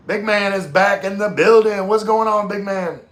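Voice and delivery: loud, high hype-man welcome; hype announcer shout. Acted. Streamer greeting a returning viewer with a loud, high hype shout. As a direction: bellow it like a ring announcer. Hyping a returning chat regular.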